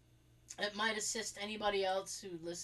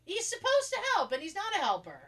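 raised, indignant complaint; 9 semitones higher, 5 dB louder than usual. Real, not acted. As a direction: protest loudly that he won't help.

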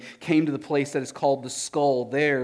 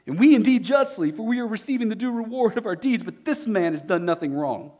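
railing criminal; 7 semitones higher, 5 dB louder than usual. Acted. Criminals on the crosses.

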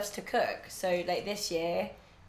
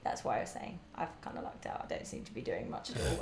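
sheepish mumble; 9 semitones lower, 7 dB quieter than usual. Real, not acted. Sheepish aside.

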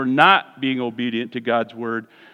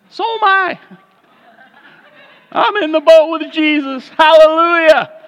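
high, loud laughing hallelujah; delighted, laughing celebration; joyful laughing praise; 12 semitones higher, 7 dB louder than usual. Real, not acted. Preacher rejoicing with the church, laughing and high.

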